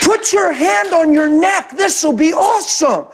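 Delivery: yelling across lanes. Retold or imitated. A friend shouts from his car window to a driver in the next lane. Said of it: "he's yelling across the lanes".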